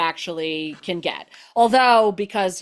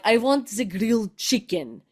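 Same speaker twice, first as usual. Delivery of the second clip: lightly imitated Latvian accent, insistent; father's Latvian accent. Latvian immigrant father ordering from the waiter, light Latvian accent. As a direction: give a hint of the Latvian accent, stubborn and sure. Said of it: "he would be like".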